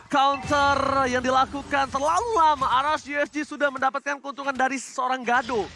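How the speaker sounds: hyped esports play-by-play; loud caster calling the play; fighting game announcer. Real, not acted. Live play-by-play call.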